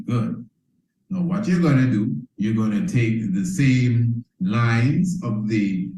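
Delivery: ordinary delivery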